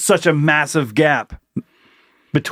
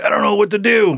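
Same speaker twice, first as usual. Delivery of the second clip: helpless new worker; 7 semitones higher than usual. Acted. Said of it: "and they're like".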